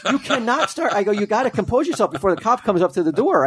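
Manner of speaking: high-pitched retold pleading; imitated flustered parent; urgent parental plea. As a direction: beg the kids to pull themselves together.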